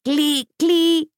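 high piping seabird cry; a high bird's call over the shore. A narrator's reading. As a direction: call out like an oyster catcher.

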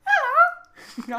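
very high, loud squeaky hello; high-pitched ten-year-old voice; helium voice. Shown as a demonstration. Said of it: "I can do it".

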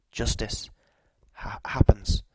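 rasping whisper breaking into a stutter; convulsive stammer. A narrator's reading. River man straining to make her understand, convulsive stammer. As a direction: force the words out through juddering jaws. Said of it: "here he broke into a convulsive spasm".

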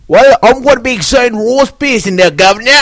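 mock English accent. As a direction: answer the pick in a jokey English voice. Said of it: "in an English voice".

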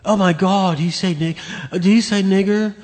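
high-pitched fake outrage; mock shocked. Host mimicking offended listeners after the clip, mock shocked. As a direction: exclaim in put-on disbelief.

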